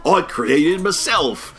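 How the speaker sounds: British droid reply. Retold or imitated. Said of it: "British accent".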